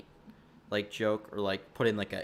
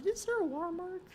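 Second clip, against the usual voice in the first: puzzled inner thought; faster than usual. Retold or imitated. Vlogger recalling a thought about the watermark, high-pitched puzzlement. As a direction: quote your own thought in a tiny high voice. Quotes own earlier thought.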